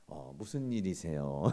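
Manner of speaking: low, slow, caring inquiry; gentle concern. Acted. Husband asks upset wife what happened, soothing. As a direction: ask softly, defusing the row. Models calm reply to anger.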